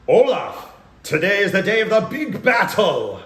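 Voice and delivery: loud, high, hammed-up battle cry; declaiming Viking brother. Improviser as Bjork answering his brother, loud proclamation. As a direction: proclaim it grandly like a stage warrior.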